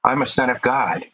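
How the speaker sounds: usual speaking voice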